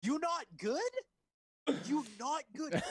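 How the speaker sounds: high, loud mock Tommy voice; doing Tommy's strange accent-ish voice; mimicking Tommy. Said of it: "He wrote that in the script".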